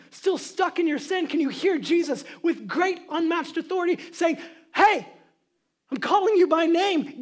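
loud, building altar call; full-force preaching. Acted. Preacher urging sinners to hear Jesus' call, loud. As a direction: thunder the call to life.

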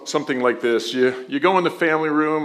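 ordinary talk, nothing marked

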